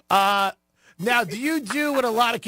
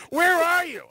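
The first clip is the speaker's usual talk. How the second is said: suspicious boss; 11 semitones higher than usual. Retold or imitated. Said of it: "He goes".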